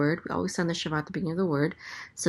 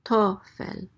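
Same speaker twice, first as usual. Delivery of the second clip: "tofel" with TO raised, fel falling; stress on first syllable; 4 semitones higher than usual. Shown as a demonstration. Teacher showing where the accent mark falls, stress on TO of "tofel". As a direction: lean on the TO in "tofel". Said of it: "the accent is on the first syllable".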